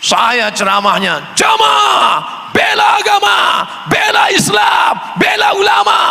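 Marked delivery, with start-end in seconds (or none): 1.3-6.0 much higher, shouting preacher rally cry; thundering sermon. Retold or imitated. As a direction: roar it at the crowd like a fiery preacher. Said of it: "Keras, menggelegar". (ceramah keras menggelegar, berteriak tinggi)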